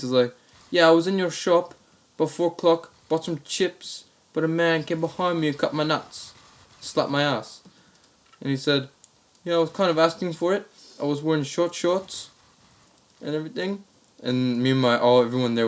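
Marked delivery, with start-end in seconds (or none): 0.7-7.6 higher-pitched Australian prank caller; put-on Aussie caller voice, pitched up; Australian accent. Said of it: "the same Australian accent". 9.4-13.8 higher-pitched put-on Aussie voice; Australian caller, pitched up; Australian accent. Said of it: "the same Australian accent".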